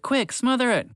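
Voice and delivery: high urgent command; urgent shout. Acted. Michael urging the others to smother the fire, high urgent call. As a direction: push them to act now. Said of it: "Michael shouted".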